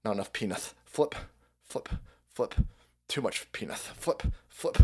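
quiet muttering zombie voice; zombie scroller. Retold or imitated. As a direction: drone it half-asleep. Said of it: "And they're like".

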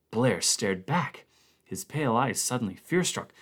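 plain reading voice

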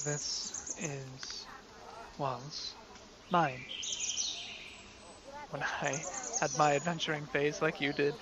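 quietly reminiscing, near a murmur; a hushed father remembering his youth; soft, nostalgic father. Acted. Father recalls his adventuring days.

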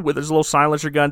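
normal talking voice

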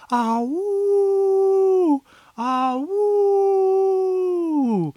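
high howling wolf cry. Acted. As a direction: howl like a wolf at the moon. Wolves howling at the city.

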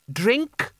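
put-on high, pampered old potentate; old sultan voice. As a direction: ask like a thirsty sultan. Creosote voiced by narrator.

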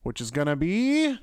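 slow, spooky drawn-out introduction; scary announcer voice. Acted. Host introducing Grayson's reading segment, hammed-up spooky. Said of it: "You sounded scary".

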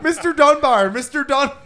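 calling the name high and loud through laughter; mock call, cracking up; laughing imitation. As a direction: giggle out the name like a creepy fan at the window. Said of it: "He's just like".

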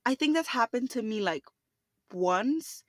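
ordinary talk

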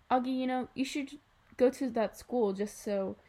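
plain reading voice

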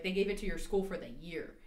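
ordinary talk, nothing marked

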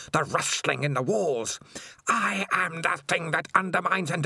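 loom and demand obedience; ranting creature. Acted. Evil Spider demanding obedience from Dangerous Beans, high and menacing. Spider's raging speech continues.

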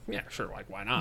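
soft, low retold shrug; offhand agreement. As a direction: agree softly as if it hardly matters. Retells offhand agreement to help coach.

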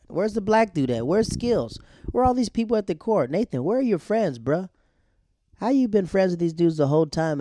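high, loud rhetorical questioning; mock indignation. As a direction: demand answers from an absent character. Mock demanding where friends went.

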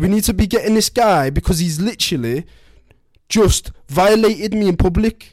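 high, loud, upset put-on Scouse voice; distraught wife. Wife begs husband for help, high distraught voice. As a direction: play the wounded wife, pitched high and loud.

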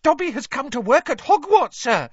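high, loud squeaky house-elf; Dobby, excited squeal. A narrator's reading. House-elf telling Harry of his new job, excited squeal. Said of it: "Dobby squealed excitedly".

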